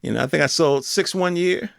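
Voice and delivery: ordinary talk, nothing marked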